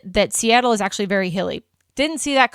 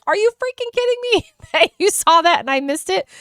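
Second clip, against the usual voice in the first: higher, disbelieving, with a laugh; mock outrage; 9 semitones higher than usual. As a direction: playfully accuse friends who saw it. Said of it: "I was like".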